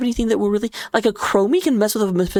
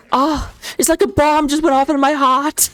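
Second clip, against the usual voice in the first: Maiev impression; 5 semitones higher than usual. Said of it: "sometimes she'll be like".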